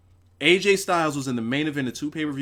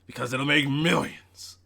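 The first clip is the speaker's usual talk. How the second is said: put-on wrestling boss voice; Vince McMahon. Finishing the viewer's donation read, mock promoter voice. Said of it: "in the Vince McMahon monotone voice".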